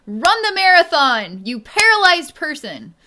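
hammed-up shout at a paralyzed person; mocking the demanding energy, loud yell. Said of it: "demanding figure".

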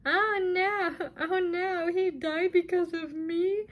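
high mock-wailing imitation; sarcastic, exaggerated boo-hoo. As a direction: mimic fake distress in a high, whiny voice. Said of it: "you're sitting here being all sarcastic".

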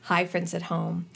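ordinary delivery

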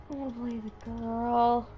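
put-on high, childish whining; high-pitched put-on complaint; mock whine. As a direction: whine it like a pouting kid. Mock complaint about female character.

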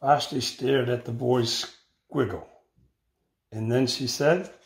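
put-on expressive read-aloud for children; picture-book reading voice; story narration. A narrator's reading. Teacher reads picture book aloud.

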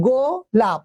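"গোলাপ" slowly as go - lap; two separate syllables. Shown as a demonstration. Teacher asking students to count, "গোলাপ" in two slow syllables. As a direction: split "গোলাপ" into go and lap, with a gap. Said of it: "দুটো অক্ষর হচ্ছে না?".

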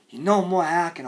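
low, soft, controlled; subdued warning. Acted. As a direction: drop to a quiet warning. Same demand, shouted then subdued.

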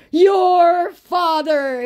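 very high, slow, drawn-out "Your father"; mock scammer misspelling. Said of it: "he said".

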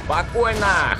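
usual speaking voice

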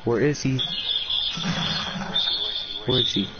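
ordinary delivery

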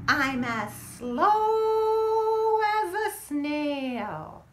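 drawn out, very slow and sung; slow as a snail. Acted. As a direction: stretch it out like a snail. Reading snail line slowly.

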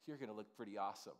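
low, soft, sly; tempting inner voice. Retold or imitated. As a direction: murmur it like a flattering whisper in your ear. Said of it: "his voice is like".

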